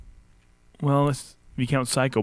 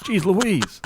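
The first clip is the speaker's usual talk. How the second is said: laughing; 6 semitones higher than usual. Real, not acted. Laughing at own math mistake.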